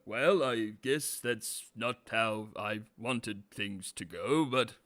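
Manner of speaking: slow, lightly put-on resigned drawl; a mild deep resigned shrug of a voice; George Takei impression. Imitating the character's resignation, slow and drawled. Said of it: "It's like a".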